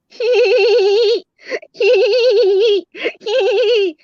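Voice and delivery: high, loud mock tittering hee hee; high giggle. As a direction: giggle at what you see. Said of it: "Nurse Christina says".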